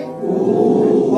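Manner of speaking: او; loud drawn vowel. Shown as a demonstration. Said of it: "کیا پڑھیں گے؟".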